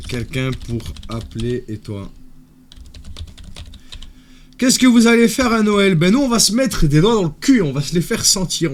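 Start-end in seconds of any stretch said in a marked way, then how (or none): 4.6-8.7 high, loud mocking tone; mock high excited voice. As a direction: ham up the question, higher and louder. Mocking caller question, crude answer.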